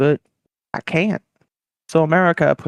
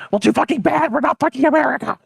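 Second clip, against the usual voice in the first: high-pitched comic scolding; hammed-up quarrel retort; mock-angry retort; 12 semitones higher than usual. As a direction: snap back in a raised, high voice. North Korea's retort in quarrel.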